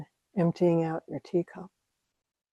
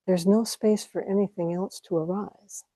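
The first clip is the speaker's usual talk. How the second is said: usual speaking voice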